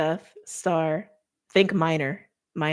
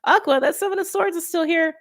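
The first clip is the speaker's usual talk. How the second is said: higher, quick sing-out to Aquarius; mock calling out; 10 semitones higher than usual. Reader re-enacts herself alerting Aqua to the card, mock insistent. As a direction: call it over and over, pitched up and insistent. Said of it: "I was like".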